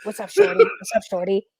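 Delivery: mock New York greeting; New York accent. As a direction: lightly imitate a New Yorker's "what's up, shorty". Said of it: "'Cause in New York".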